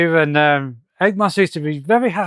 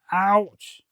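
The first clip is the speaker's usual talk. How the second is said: drawn-out, higher-pitched ouch; mock pained ouch; 4 semitones higher, 4 dB quieter than usual. Reviewer reading Sonic's hit aloud, mock pained cry. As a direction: act lightly hurt, stretching the word.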